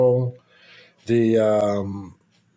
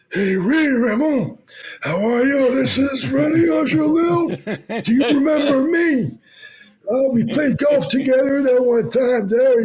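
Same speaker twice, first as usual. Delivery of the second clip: much higher put-on voice of the star's husband; imitating René Angelil; 13 semitones higher, 4 dB louder than usual. René Angelil phoning Ray about a trade, much higher impression voice. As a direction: pitch it way up like a friendly older caller. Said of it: "You sound just like him".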